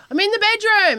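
very high sing-song call. Retold or imitated. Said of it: "sometimes I'll go".

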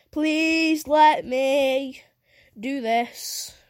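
drawn-out desperate plea; pleading. Real, not acted. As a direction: beg the game to load. Pleading with slow loading game.

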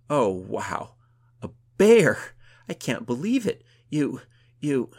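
loud, high, astonished outburst; giddy amazement. A narrator's reading. Gunhild marvels at her friend's feat, giddily amazed. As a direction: burst out, too amazed to finish.

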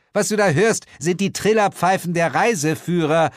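high, matter-of-fact voice; Sheila, brisk. Acted. Sheila waves off the narrator's complaint, brisk. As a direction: explain it off impatiently.

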